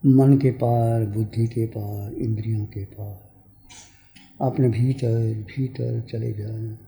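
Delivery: calm, spaced-out phrases settling into a low voice; guided meditation. Real, not acted. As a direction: guide them inward, letting phrases sink and fade.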